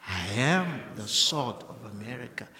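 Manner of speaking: ordinary talk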